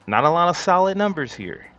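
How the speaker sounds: high, loud exasperated complaint; frustrated outburst. Real, not acted. Frustrated at missing data.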